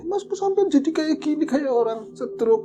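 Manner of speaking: worried wife. Retold or imitated. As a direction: quote the frightened wife pitched high. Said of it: "katanya begitu".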